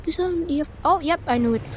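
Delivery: normal talking voice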